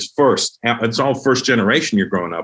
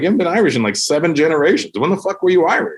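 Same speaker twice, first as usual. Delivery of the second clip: higher-pitched comic disbelief; mock incredulous challenge; 6 semitones higher, faster than usual. Comic disputing an American's Irish claim, incredulous. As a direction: challenge them as if it's absurd.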